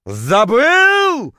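very high, loud, drawn-out howl; outraged howl. A narrator's reading. Poisoned diver to the guide who forgot to warn him, outraged howl. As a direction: stretch the word into a wounded howl. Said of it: "точнее, даже завыл я".

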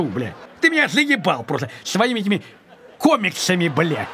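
normal talking voice

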